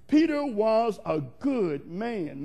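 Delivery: slow, deliberate insistence; slow emphasis. Real, not acted. Preacher insists Peter was good, slow and emphatic. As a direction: draw out each word to insist on it.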